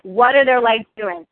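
ordinary delivery

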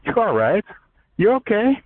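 lightly voiced kindly God; God reassuring. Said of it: "God's like, you know, my higher power's like".

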